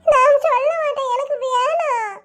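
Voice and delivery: high-pitched tearful refusal; crying. Acted. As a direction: whine and cry like a child refusing a lesson. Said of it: "அழாத". (அழுதுகொண்டே அடம்பிடிக்கும் குரல்)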